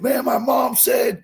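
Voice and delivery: high, slow, excited mock shout; excited kid. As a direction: play the thrilled young fan reading his mom's words. Fan's excited DM retold.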